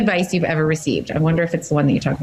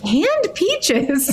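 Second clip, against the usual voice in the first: much higher incredulous echo; surprised; 12 semitones higher than usual. Real, not acted. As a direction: repeat it back in pure disbelief. Echoing a disliked food in disbelief.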